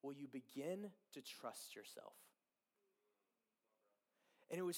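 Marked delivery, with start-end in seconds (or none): 0.0-2.1 soft, measured retold question; God speaking clearly. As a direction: ask it softly, as the Lord to him. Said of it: "the Lord said so clearly".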